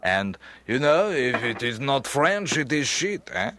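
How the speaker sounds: French accent with an overtone of disdain; disdainful French accent. Shown as a demonstration. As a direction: put on a haughty, unhurried French accent. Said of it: "do a French accent with an overtone of disdain".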